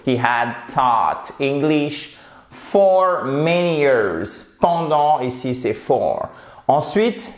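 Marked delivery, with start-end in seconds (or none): 0.1-4.6 slow model sentence, "taught" between A and O; drawn-out teaching pronunciation of "taught"; careful English model. Shown as a demonstration. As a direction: enunciate slowly, with "taught" held between ah and oh. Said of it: "c'est entre le A et le O".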